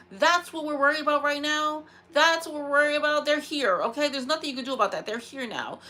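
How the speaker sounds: worked-up, exasperated scolding; irritated, mad. Real, not acted. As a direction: vent in indignation at someone who missed the point. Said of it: "I was just so irritated".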